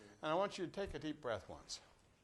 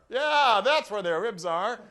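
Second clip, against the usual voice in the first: laughing; 4 semitones higher, 12 dB louder, slower than usual. Real, not acted.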